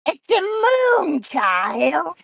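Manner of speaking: very slow, eerie witch croon; better witch voice. Pretend. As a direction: draw it out like a witch. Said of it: "Let me redo that".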